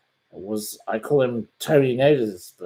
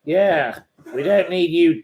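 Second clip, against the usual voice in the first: mocking dismissal; 6 semitones higher, 6 dB louder than usual. Real, not acted. Ganging up on the troll.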